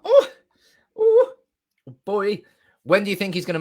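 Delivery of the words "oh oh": high, loud short whoops; excited whoop. Real, not acted. Fan bursting after the secret, loud high whoops. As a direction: burst out with sharp high oohs.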